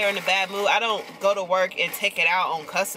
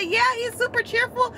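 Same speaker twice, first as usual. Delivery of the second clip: much higher, polite stranger on the phone; caller's voice. Retold or imitated. Retelling the stranger who called about her dog, high polite voice. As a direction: lift way up into the caller's sweet phone voice.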